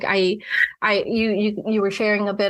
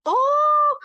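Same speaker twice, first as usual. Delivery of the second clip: mock whine. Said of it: "It's like".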